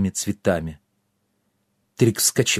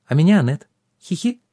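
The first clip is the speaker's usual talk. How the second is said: pixie; 6 semitones higher than usual. A narrator's reading. Giggly pixie.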